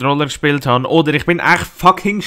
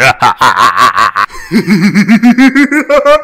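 loud evil cackle; demonic laugh; 4 semitones higher, 7 dB louder than usual. Acted. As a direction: cackle like a villain. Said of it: "eine dämonische Lache".

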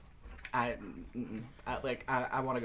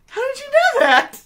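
much higher and louder, a stunned squeal; shocked disbelief; 16 dB louder than usual. Retold or imitated. Retelling her shock at Jeremy naming the company, stunned squeal. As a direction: blurt it out, floored that he knew.

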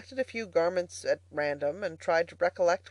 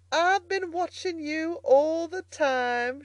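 high, drawn-out, hammed-up mirthful gasp; gasped through laughter; 10 semitones higher, 3 dB louder, slower than usual. A narrator's reading. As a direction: stretch it high as if weak from laughing. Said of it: "she gasped".

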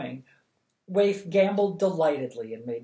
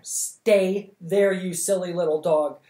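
cross order; 4 semitones higher, 8 dB louder than usual. Acted. Dumping the dog back, third time.

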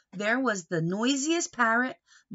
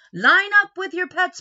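loud, higher call to the crowd; calling out loudly; 9 semitones higher, 11 dB louder than usual. A narrator's reading. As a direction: call the line-up across the hall. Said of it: "the judges called".

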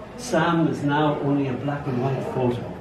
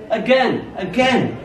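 mild shout; 6 semitones higher, slower than usual. A narrator's reading. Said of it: "shout both ball and wall".

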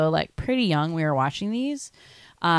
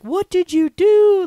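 high, loud, slow what did you do; hammed-up outraged dad, drawn out; mock horrified yell at his son; shocked dad yelling; 13 semitones higher, 10 dB louder, slower than usual.

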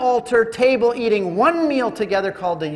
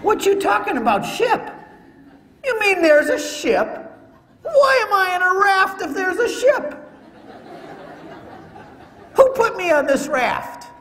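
can't believe there's a ship; astonished; 11 semitones higher than usual. Acted. Stunned to learn of the ship.

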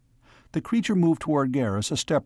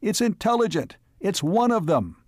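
high, insistent pleading; urgent cry; 8 semitones higher than usual. A narrator's reading. Man defending the alien to gunmen, crying out urgently. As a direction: cry out to convince them. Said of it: "he cried".

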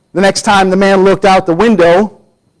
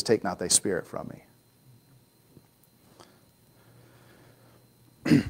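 the verse dropping "Holy", much softer; without holy, softer; 10 semitones lower, 17 dB quieter than usual. Shown as a demonstration. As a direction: quietly say "thy spirit" without "Holy". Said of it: "I don't think he says holy".

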